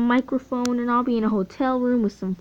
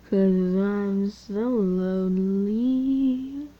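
lonely meme voice; much slower than usual. Acted. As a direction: moan it like a sad meme. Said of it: "so I'm just gonna make them say".

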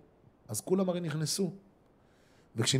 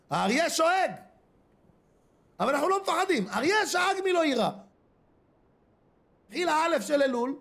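fiery preaching; 8 semitones higher than usual. Acted. Preacher urging congregants to wake for Elul, fiery. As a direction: stir the crowd awake with rising force.

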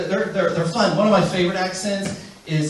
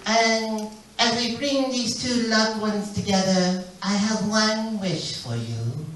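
put-on slow, solemn Korean-accented wedding blessing; Korean pastor's accent; slower than usual. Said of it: "really interesting accent".

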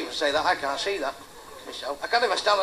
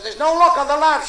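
high, put-on Welsh woman's voice; hammed-up Welsh lilt; mock Welsh accent; 6 semitones higher, 4 dB louder than usual. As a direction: imitate a Welsh wife, pitched up. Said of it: "What kind of people talk like that?".

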